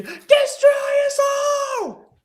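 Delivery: very high, drawn-out scream; Billy screaming. Retold or imitated. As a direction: scream it out long and high, imitating a cartoon shriek. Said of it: "Billy screaming".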